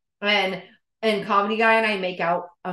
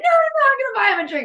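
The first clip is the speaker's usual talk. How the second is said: very high, fast, loud insistence; hammed-up squeaky impression of a keen date; insistent offer; 7 dB louder, much faster than usual. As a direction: overrule them with giddy insistence.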